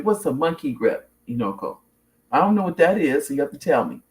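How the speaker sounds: usual speaking voice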